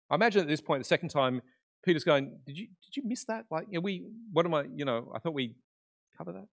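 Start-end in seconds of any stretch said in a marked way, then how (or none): 2.5-6.5 soft, halting, raised at the start; tentative puzzlement. Retold or imitated. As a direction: query it timidly under your voice. Imagining Peter puzzled by repeated question.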